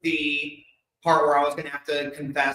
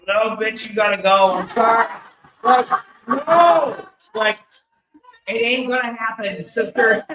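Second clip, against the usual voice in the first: higher, louder sassy brush-off; sassy refusal; 7 semitones higher, 8 dB louder than usual. Retold or imitated. Turning down the girlfriend with a higher, louder sassy no. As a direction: toss it off like a diva, voice up. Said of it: "And I'm like".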